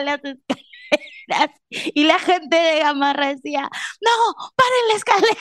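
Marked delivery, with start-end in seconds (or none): 4.0-5.4 high mock shout; imitated crowd shout. Gamarra shoppers yelling to stop the escalator, high mock shout.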